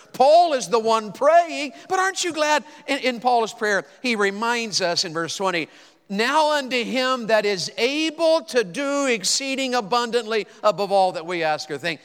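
high, loud, slow preaching build; preaching at full force. Acted. Preacher declaiming verse twenty to the congregation, full force. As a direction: lift the verse up like a shout of praise. Preacher rising to scripture climax.

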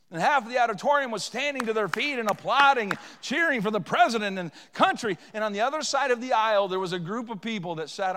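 loud, driving sermon delivery; forceful, pressing testimony from the pulpit; impassioned preaching. Real, not acted.

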